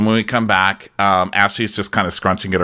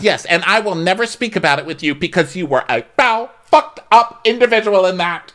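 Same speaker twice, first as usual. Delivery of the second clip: mock outrage; 9 semitones higher than usual. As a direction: imitate a reality-show tirade. Acted quarrel, insulting a castmate.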